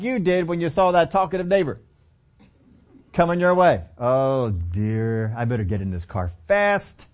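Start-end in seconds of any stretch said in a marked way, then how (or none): 4.0-7.1 lower, hurried mock worry; mock dismay. You avoiding a talkative neighbor, mock dismay. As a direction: duck away from the chatty neighbor.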